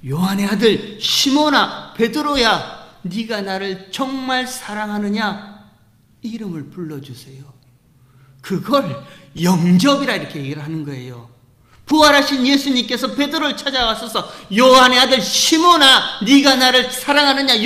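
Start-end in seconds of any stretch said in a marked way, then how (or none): 0.1-5.6 loud, slow, drawn-out call of the name. Acted. Risen Jesus calling Peter by name, loud and slow. 11.9-14.3 loud, ringing sermon climb; full-force preaching. Acted. Pastor calling out to the congregation, full-force preaching. 14.6-17.6 very loud, higher ringing call of the name. Shown as a demonstration. Risen Jesus crying out to Peter by name, loud calling. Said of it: "이름을 불러주시는 것이".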